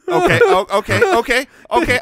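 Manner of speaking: high, eager okays; excited. Real, not acted. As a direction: burst with a sudden idea. Excited idea.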